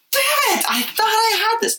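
high, squeaky mock exasperation; squeaky mock dismay. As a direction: act the defeated mom. Toddler foils locked doors.

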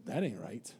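low, very soft aside; imitating someone's quiet disbelief; muttered aside. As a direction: mutter it under your breath as if doubting. Acts out a private doubting reaction.

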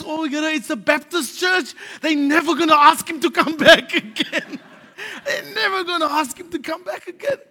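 laughing through the words. Retold or imitated. Preacher retelling the camp, laughing. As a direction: giggle out the worry as you retell it.

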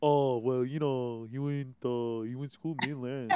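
lightly voiced sheepish boy; boy explaining to mom. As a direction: explain it low and soft to your mom. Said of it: "And it's like".

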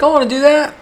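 put-on high childlike eagerness; eager want. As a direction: beg for it like a kid. Amazed, wanting the trick.

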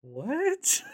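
sudden high shocked squeak; baffled what. Real, not acted. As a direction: jump high in disbelief. Baffled by the odd question.

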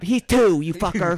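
high, loud mock-angry mom; a mother firing back at her son's excuse; mock mom snapping. Mom retorting.

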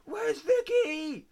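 very high, hammed-up demanding cry; mock crazed wailing. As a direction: wail like someone losing it. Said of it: "he's going crazy".

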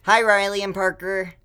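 cheery greeting. Real, not acted. Greeting kids on podcast.

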